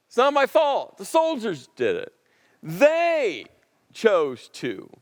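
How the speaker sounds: defensive whine, shoving off blame; whiny excuse. Retold or imitated. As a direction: pass the blame like a sulking child. Saul whining excuse, raised.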